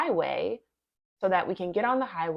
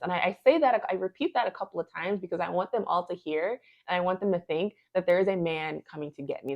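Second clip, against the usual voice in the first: usual speaking voice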